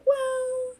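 very high, drawn-out hedge; Luann. Retold or imitated. Retelling Luann dodging the host's question, high coy impersonation. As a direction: imitate her stretching it out evasively up high. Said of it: "And Luann's like".

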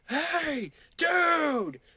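high, loud yell of a bouncer; mock bouncer shout; shouted warning. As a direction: call out sharply to stop him. Bouncer yelling warning in story.

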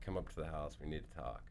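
hushed, low, serious father; upset dad. Retold or imitated. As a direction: imitate a dad's ominously quiet summons. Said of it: "this tone of voice was like".

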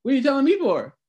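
high-pitched exasperated brush-off; retold indignant pushback. Police and housing staff arguing over marijuana, indignant pushback. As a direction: hand the problem back in mock irritation. Imitates police passing problem back.

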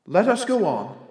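observe it gently like a ghost. A narrator's reading. Ghost's lines in the tale.

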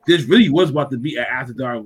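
usual speaking voice

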